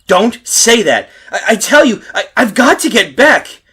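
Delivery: loud, high, frantic insistence; desperate shout. Acted. Man desperate to return home.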